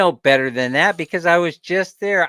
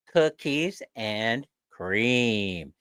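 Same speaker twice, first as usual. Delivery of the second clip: slow, dragged-out "cookies and cream"; drawn-out punchline; 7 semitones lower, 4 dB quieter, much slower than usual. Real, not acted. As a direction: stretch every word with disdain. Punchline of the ice cream rant.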